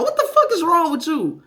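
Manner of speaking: high-pitched exasperated mock telling-off; retold outraged scolding. Parent to child bouncing in the street, outraged. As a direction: replay your outrage, pitch jumping up.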